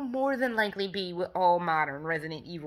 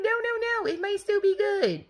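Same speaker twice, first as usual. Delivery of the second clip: much higher, protesting no no no; high pleading voice; 12 semitones higher than usual. Retold or imitated. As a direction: squeak it out like a pleading fan. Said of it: "I was like".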